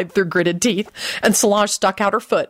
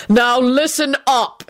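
higher, slow, drawn-out call to attention; mild shout; 6 semitones higher, slower than usual. A narrator's reading. Quentin warning the elderly Fanshaws, mild threatening shout. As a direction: call out firmly, short of a full shout. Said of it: "he shouted and gave both".

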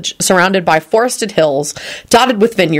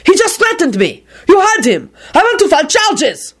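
outraged complainer; 12 semitones higher than usual. Acted. Hulbert complaining to Percy.

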